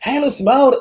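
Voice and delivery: Oklahoma greeting. Retold or imitated. Oklahoma locals greeting the Jamaican newcomer, Southern accent. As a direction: greet her with a Southern lilt. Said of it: "And then you hear".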